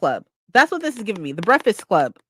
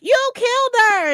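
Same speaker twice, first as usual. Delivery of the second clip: shocked accusation; 13 semitones higher, 5 dB louder than usual. Retold or imitated. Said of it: "somebody else comes in and is like".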